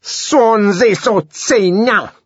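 high, loud, slow mocking snarl; derisive ferocity. John cursing the songster in Zuni, derisive and loud. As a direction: spit it out with scorn. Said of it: "with what derisive ferocity".

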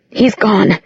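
ordinary delivery, nothing marked

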